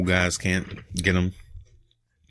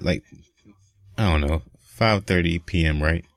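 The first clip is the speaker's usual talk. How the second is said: ordinary talk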